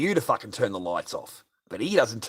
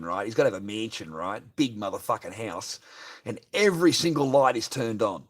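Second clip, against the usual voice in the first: normal talking voice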